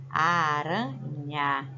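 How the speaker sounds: Aranha stretched slowly, syllable by syllable; teacher drawing out Aranha for kids; slow drawn-out word. Shown as a demonstration. Teacher to pupils, slowly spelling out Aranha. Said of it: "Se eu tirar um R".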